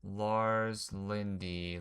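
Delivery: drawn out slowly, puzzling over it; slow musing. Real, not acted. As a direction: stretch the name while thinking. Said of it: "Let me figure something out".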